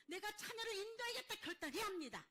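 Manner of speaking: high, loud preacher's declaration; forceful resolve. Acted. Preacher voices a firm resolve. (높고 크게 외치는 결단)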